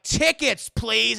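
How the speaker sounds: loud, high barked demand. Retold or imitated. Indy disguised as a waiter demanding the ticket, loud. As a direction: bark it out at him.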